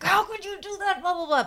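high, exasperated mock scolding; mock furious parent. Parents scolding their lost child, hammed-up high scolding. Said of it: "my parents would be furious with me".

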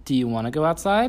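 put-on high, sing-song voice for a small child; reading to a toddler. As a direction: coax a toddler, bright and playful.

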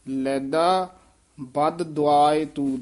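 slow, with both ਦ sounded in ਬਦ ਦੁਆਏ; each ਦ in ਬਦ ਦੁਆਏ clearly separate; slow careful demo. Shown as a demonstration. Teacher slowly shows the student both ਦੱਦੇ. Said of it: "ਦੋਵੇਂ ਦੱਦੇ ਉਚਾਰਨ ਕਰਨੇ ਆ".

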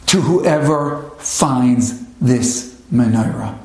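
slow, grave reading; solemn letter reading. Acted. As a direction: read each phrase slowly, heavily. Reads the Holocaust note.

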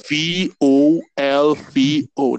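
slow, loud letter by letter; spelled out. Shown as a demonstration. Spelling the brand for a confused friend, slow and loud. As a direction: spell out V O L V O slowly, each letter punched. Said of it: "Just spell it".

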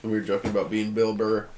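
ordinary talk, nothing marked

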